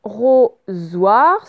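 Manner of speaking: loud, very slow, syllables pulled apart. Shown as a demonstration. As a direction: sound it out slowly for pupils. Correction, découpe le mot en syllabes.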